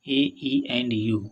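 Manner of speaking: ordinary delivery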